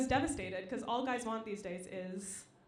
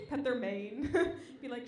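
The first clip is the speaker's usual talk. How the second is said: usual speaking voice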